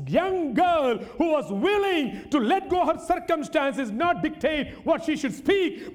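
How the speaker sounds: slow, ringing, stretched-out proclamation; full-force preaching. Real, not acted. Preacher declaring Naaman's faith in Israel's God, full-force preaching. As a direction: declare each phrase slowly and fervently.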